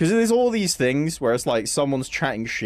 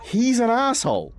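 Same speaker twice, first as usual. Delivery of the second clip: quoting Marty at the end; 11 semitones higher than usual. Retold or imitated. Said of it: "he's like".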